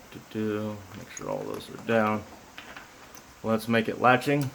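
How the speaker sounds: talking with keys in mouth; key in teeth. Real, not acted. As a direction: speak around a key held in teeth. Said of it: "Let me not hold keys in my mouth".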